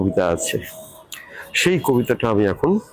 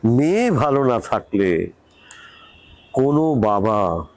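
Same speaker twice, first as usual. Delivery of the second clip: poem recitation. Acted. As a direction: recite it unhurriedly, letting each line fall at the end. Recites own poem after announcing it. (ধীরে, থেমে থেমে কবিতা আবৃত্তি)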